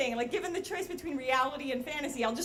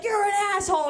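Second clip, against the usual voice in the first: loud, higher-pitched mock yell; imitated street heckle; 7 semitones higher, 8 dB louder, faster than usual. Everyone on the street to the smoking car's driver, mock yell. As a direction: holler it like an outraged passerby.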